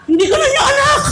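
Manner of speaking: very high, squeaky, laughing; laughing child voice; mock hurt child. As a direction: whine it like a disowned kid.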